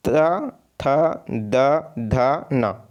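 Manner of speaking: dental त, थ, द, ध, न, tongue on the teeth; spaced drill of dental त, थ, द, ध, न; pronunciation demo. Shown as a demonstration. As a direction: sound out त, थ, द, ध, न one by one. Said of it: "जिसका उच्चारण दांत से होगा".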